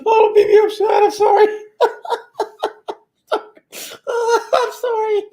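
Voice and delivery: squeaky, very high words through laughter; helpless high-pitched cracking up; real laughing fit. Real, not acted. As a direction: lose it completely while trying to apologize. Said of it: "I got a good laugh".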